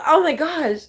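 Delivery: usual speaking voice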